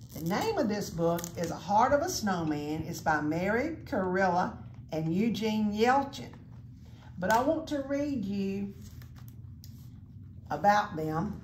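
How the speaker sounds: plain reading voice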